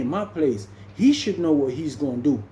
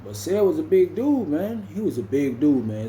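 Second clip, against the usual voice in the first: usual speaking voice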